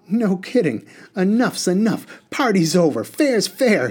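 high, loud, irritated little genie; snappish. A narrator's reading. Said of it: "he snapped".

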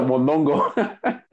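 drawn out Mondongo with a giggle; laughing. Retold or imitated. Laughing repeat of the word.

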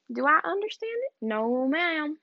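high rhetorical question, then no ma'am; a touch of Southern sass; mock sassy answer. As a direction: play both asker and answerer. Mock self-interview.

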